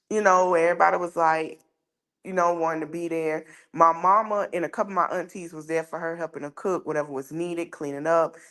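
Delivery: ordinary talk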